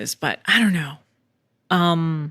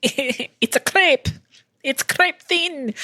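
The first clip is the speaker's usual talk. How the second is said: mock horror joke; 9 semitones higher, much slower than usual. Joking the machine flattens boobs.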